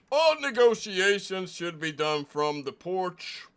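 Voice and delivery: a proud small-town deputy guarding his porch; stern deputy marshal. Acted. Plays deputy marshal character.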